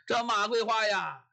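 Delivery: ordinary delivery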